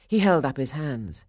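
usual speaking voice

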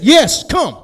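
loud, high, decisive summons; emphatic call. Acted. Jesus answering Peter on the water, emphatic call. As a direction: call it out as a firm command.

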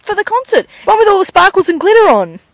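put-on high, loud wailing kid; hammed-up daughter in a panic; upset daughter. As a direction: wail it like a distressed child. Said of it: "She goes".

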